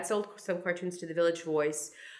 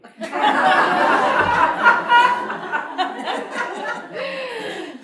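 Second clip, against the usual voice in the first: a long, high, loud burst of real laughter; big laugh; 10 dB louder than usual. Real, not acted. Laughing at a shocking recollection.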